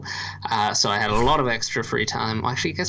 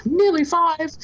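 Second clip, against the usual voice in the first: put-on much higher, slow childish voice; mock little kid voice; 4 dB louder, slower than usual. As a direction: say it like a small kid proudly adding "nearly". Mocking a kid's age claim.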